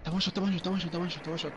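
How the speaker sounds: frantic one-shot warning; panicking gamer. Real, not acted. Enemy is one shot left.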